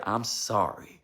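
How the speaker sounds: a gentle hushed "I'm sorry"; tender, quiet "I'm sorry" shown to the congregation; soft apology. Shown as a demonstration. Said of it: "It's something about that word".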